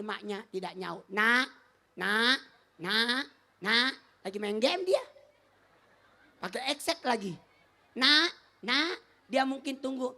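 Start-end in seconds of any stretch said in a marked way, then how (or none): 1.1-3.9 repeated rising calls; calling out. Acted. Mother calling child. 7.9-9.0 louder rising calls; calling out. Acted. Mother calling again.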